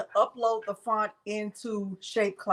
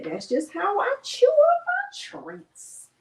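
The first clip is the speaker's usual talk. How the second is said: high, slow squeaky dog voice speaking for her pet; talking for the chewing dog in a high slow squeak; voicing the dog; slower than usual.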